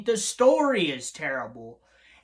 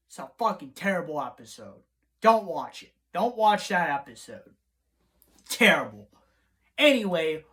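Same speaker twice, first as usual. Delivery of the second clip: usual speaking voice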